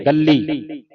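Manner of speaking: ਗੱਲੀ with a held double l; tight doubled consonant. Shown as a demonstration. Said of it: "ਇਹਨੂੰ ਕੱਸ ਕੇ ਬੋਲੋ".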